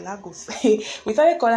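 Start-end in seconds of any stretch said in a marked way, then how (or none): 0.0-0.5 "Lagos" as LAH-goose, softly; mimicked Lah-goose. Campers naming that girl, hushed "Lagos" as Lah-goose. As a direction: drop "Lagos" quietly, ending like "goose". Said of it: "started calling her Lagos".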